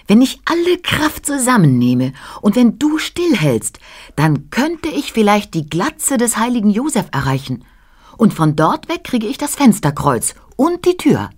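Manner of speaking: high, excited little flea voice; breathless flea. A narrator's reading. Flea plans his leap for the child, high and breathless. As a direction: lay out the plan as a tiny, eager creature. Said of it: "flüsterte der atemlose Floh".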